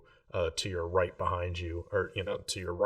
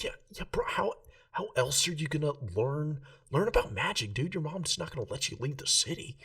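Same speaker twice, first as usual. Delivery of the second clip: higher, breathy stage whisper of a tipsy bro; drunk loud whisper; 8 semitones higher than usual. Acted. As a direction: push your friend in a loud drunken whisper. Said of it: "loud whisper voice".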